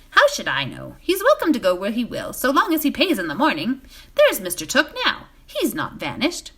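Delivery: brusque landlord waving it off. A narrator's reading. Butterbur voiced.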